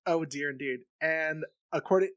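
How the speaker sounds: ordinary talk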